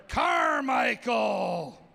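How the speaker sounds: slow drawn-out name call; announcer hyping the crowd for each corgi; ring announcer intro. Acted. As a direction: stretch the name like a boxing intro.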